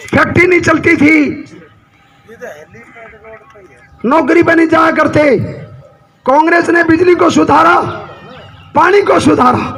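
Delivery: full-voiced political speech, phrase by phrase; forceful stump-speech delivery; rally oratory. Real, not acted. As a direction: address a big crowd in emphatic, sustained bursts.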